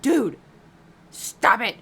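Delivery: loud, sharp pretend shout; mock angry shout. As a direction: yell at him to quit, half-joking. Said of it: "I'd be pissed".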